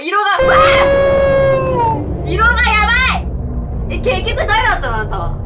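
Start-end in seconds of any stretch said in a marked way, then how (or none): 0.0-4.0 loud, high startled yell; startled scream. Real, not acted. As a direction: shriek in real fright. Startled by a scary face.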